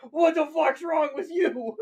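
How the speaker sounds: mock panic rising to a shout, laughing through it; panicked waking yell. Victim waking up armless, building to a panicked laughing shout. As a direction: wake up screaming at the lost arm, half laughing. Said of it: "you're gonna awake and go".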